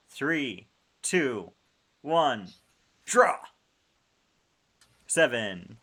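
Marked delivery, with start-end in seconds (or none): 0.1-3.4 countdown getting higher and louder; building countdown. Real, not acted. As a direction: build to a shouted draw.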